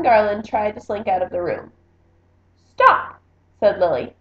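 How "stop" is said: high, loud stop; a commanding little queen; sharp command. A narrator's reading. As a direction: freeze him in his tracks. Lily halts the fleeing cousin.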